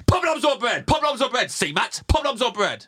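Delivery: high, loud waiter calling out; waiter shout. Pretend. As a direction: call the order like a busy waiter. Waiter voice asking at restaurant.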